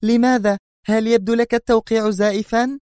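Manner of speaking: piercing angry question; sharp outcry. A narrator's reading. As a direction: snap back at the clerk. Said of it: "صاحت في حدة".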